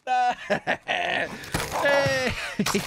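long, high cackling laugh; gloating cackle. Real, not acted. Player gloating over a kill, high cackle. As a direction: cackle at length, high and gleeful.

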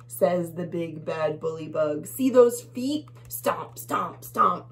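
usual speaking voice

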